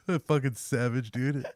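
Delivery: laughing. Real, not acted.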